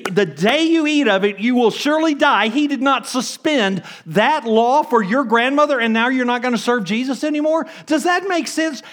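high, loud, laughing disbelief; loud, high, incredulous preaching; incredulous outburst. Real, not acted. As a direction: preach it with mock outrage. Preacher rises in incredulous outrage.

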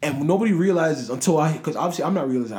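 high, loud and fast; excited. Real, not acted. Retelling the moment to viewers, high and hurried. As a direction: tumble the words out, worked up.

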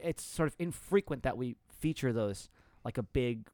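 ordinary talk

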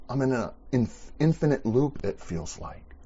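normal talking voice

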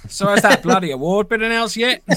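a playful Australian twang for Phil's question; Australian accent. Shown as a demonstration. Podcast host redoes Phil's chat question in an Australian accent. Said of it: "You gotta say it like Phil says it".

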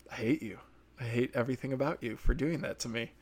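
soft, wounded grievance; quiet resentment. Acted. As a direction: say it softly, with a grudge. Resentment at a disappointing ending.

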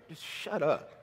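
half-whispered irritation; exasperated shut up. Retold or imitated. Preacher voicing what he wanted to tell a believer, fed-up mutter. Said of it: "I wanted to be like".

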